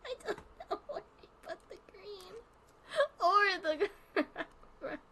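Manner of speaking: laugh-crying. Real, not acted. Laugh-crying over lost colour.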